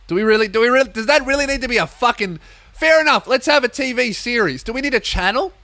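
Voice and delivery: high, loud incredulous rant; exasperated rant. Retold or imitated. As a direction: rant at the TV in disbelief. Ranting at TV in quote.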